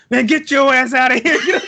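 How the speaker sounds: loud, high, slow angry brush-off; Lenny snapping. Retold or imitated. Lenny from the game throwing the player out, loud and angry. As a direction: bark him out of the room. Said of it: "and then he's like".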